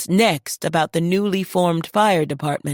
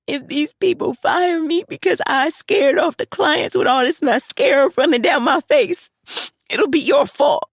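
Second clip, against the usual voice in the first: high-pitched, scolding through happy tears; mock-blame dad while crying; teary daughter; 10 semitones higher, 4 dB louder than usual.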